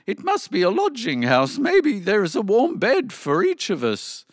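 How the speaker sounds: high, excited donkey call; shouting donkey. A narrator's reading. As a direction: call the hopeful news down to friends. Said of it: "the donkey shouted".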